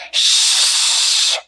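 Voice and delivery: shushing. Acted. Shushing in theater.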